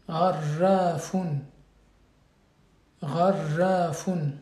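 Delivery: Gharrafun drawn out slowly, the r strongly rolled. Shown as a demonstration. Teacher corrects a student's reading, slow model with a rolled r. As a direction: stretch Gharrafun out and trill the r. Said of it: "Donc c'est un R roulé".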